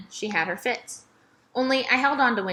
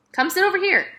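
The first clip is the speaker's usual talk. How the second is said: much higher, sweet invitation; old lady voice; 10 semitones higher, 5 dB louder, faster than usual. Acted. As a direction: beckon her over kindly. Miss Franny invites warmly.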